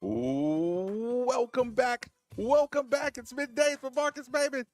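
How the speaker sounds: high, stretched-out show opener; radio host intro. Acted. Radio host welcoming listeners back after break, sung-out intro. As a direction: sing out a big welcome back.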